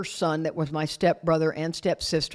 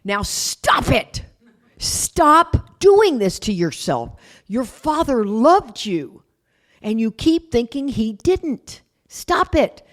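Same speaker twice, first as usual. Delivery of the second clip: slow, firm scolding, climbing higher on the last stop it; tough stepmother; 4 semitones higher, 3 dB louder, slower than usual. Retold or imitated. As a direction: tell him off slowly and firmly, like a tough old lady. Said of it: "She was tough".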